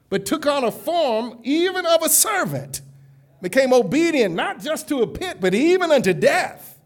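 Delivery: loud, rising pulpit preaching; preaching at full force. Acted. Preacher lifting the congregation, fervent full-force preaching. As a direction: build the crowd up with each line.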